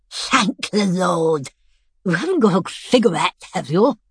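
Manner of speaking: put-on an old rascal begging a smoke; sly old lady. Missus B, waking up.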